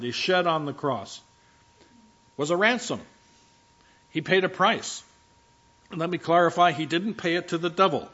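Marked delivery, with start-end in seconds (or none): none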